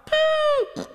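high squeak of a small animal. Acted. Piglet squeaking up at Pooh from below. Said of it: "squeaked the voice".

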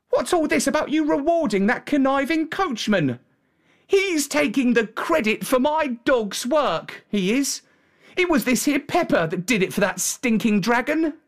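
high-pitched country lad up in arms; boy's indignant complaint, pitched high; indignant shepherd boy. A narrator's reading. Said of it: "sent him flying into a rage".

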